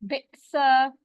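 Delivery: bisa read out slowly and clearly; careful recitation. Shown as a demonstration. Teacher modelling बि'सा for students, clear recitation. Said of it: "teacher models word".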